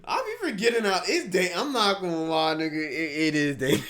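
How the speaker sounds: high laughing talk. Real, not acted. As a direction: laugh through the words. Reactor laughing hard.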